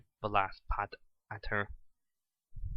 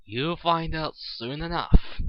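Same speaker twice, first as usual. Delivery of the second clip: loud, slow, gloating shot back; slow, loud, taunting reply; sharp comeback; 4 semitones higher, 9 dB louder, slower than usual. A narrator's reading. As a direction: snap it back with a smug grin. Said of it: "Eggman shot back".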